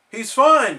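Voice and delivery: loud high insist; crying out. Acted. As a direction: cry it out loud. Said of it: "cried Patrick".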